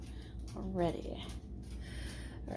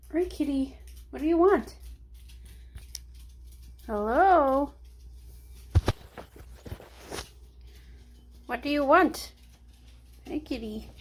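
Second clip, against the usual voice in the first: pet talk; 5 semitones higher than usual. Real, not acted. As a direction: coo at the kitty, asking what it wants. Talking to her meowing cat.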